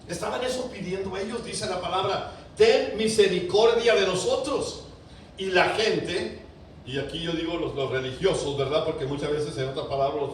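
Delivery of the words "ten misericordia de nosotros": slow, loud, high cry for mercy; preacher crying out the blind men's plea, loud and slow. Acted. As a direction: lean hard into every word. Preacher repeats the plea forcefully.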